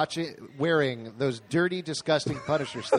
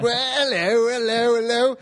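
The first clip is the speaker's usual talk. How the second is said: put-on hammy British accent, higher pitched; bad British accent; 7 semitones higher, 4 dB louder, slower than usual. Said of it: "a bad British accent".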